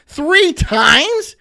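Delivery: loud, high outraged question; can't believe it happened; repeated shouted disbelief; incredulous. Real, not acted. Incredulous rant.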